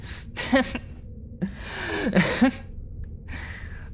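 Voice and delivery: a soft, airy laugh after the boast. Acted. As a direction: laugh softly at her own defiance. Laugh after proud declaration.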